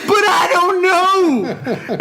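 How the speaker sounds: very high, drawn-out anguished scream; hammed-up tearful shriek of helplessness; mock screaming. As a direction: scream it out like a man breaking down. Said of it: "And he's screaming".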